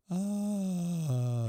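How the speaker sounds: high, delighted ah; a mock gasp of surprise, pitched high; surprised gasp. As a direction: gasp like you've just seen roses. Imitating fiancee's surprise.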